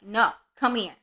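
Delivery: loud, firm call to a puppy; retold pet scolding, loud; mock command.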